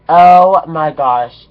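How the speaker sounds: a slow, loud "oh my gosh"; loud drawn-out gasp. Real, not acted. Telling viewers the shocking part, loud stretched exclamation. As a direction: belt it out in stunned disbelief. Building to the big reveal.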